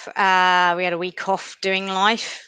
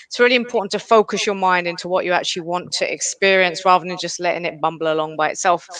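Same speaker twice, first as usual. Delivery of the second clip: ordinary talk, nothing marked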